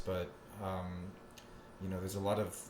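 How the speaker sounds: ordinary talk, nothing marked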